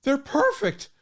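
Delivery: high, slow amazed gasp; gasping delight. Retold or imitated. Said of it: "she gasped and she said".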